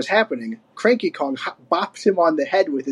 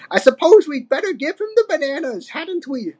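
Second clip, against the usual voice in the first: put-on high-pitched grumbling old ape; Cranky, grudging; 11 semitones higher than usual. A narrator's reading. Old ape answering the ransom note, grudging. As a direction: give in crabbily, voice pitched up. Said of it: "grunted Cranky".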